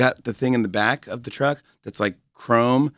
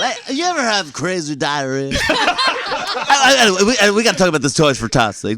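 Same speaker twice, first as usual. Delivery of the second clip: parody morning DJ; 7 semitones higher than usual. Pretend. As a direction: blast it like a shock-jock opening the show. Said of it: "this is a different guy".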